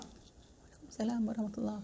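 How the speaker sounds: hushed reply meant not to be heard; very soft reply. Acted. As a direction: murmur the greeting back so it won't carry. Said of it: "Pelan aja".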